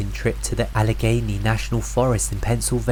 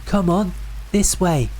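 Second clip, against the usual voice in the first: higher, raised luring call; 7 semitones higher than usual. A narrator's reading. The mimic using the narrator's voice to lure them, higher and eager. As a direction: beckon them eagerly from afar. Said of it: "My voice called again".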